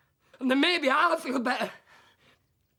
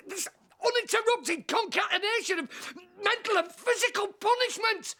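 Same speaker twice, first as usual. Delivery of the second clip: despairing rant; 6 semitones higher than usual. Acted. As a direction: rail against life itself.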